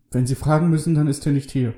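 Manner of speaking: high-pitched, nervy prisoner; lightly voiced jittery man; anxious prisoner. A narrator's reading. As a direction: answer in the frightened man's high voice. Frightened prisoner answers the sheriff.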